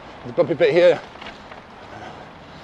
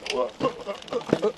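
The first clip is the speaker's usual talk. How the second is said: laughing; 6 semitones higher, 9 dB quieter than usual. Real, not acted. Amused by camera going off-road.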